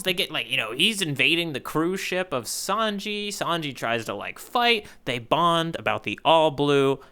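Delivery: ordinary talk, nothing marked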